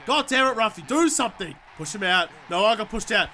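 ordinary delivery

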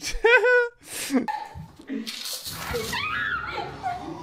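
high helium shriek of laughter. Real, not acted. Watching siblings fight, shrieking laugh. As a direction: scream with laughter.

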